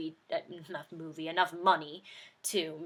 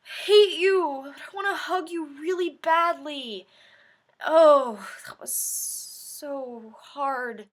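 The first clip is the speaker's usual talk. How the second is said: mock anguish; 7 semitones higher, 5 dB louder, slower than usual. Torn between hate and love.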